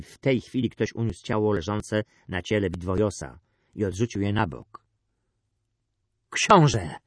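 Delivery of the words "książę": loud, falling exclamation; forceful one-word address; urgent call. A narrator's reading. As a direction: call out to the prince. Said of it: "rzekł Terteus, oddychając ciężko".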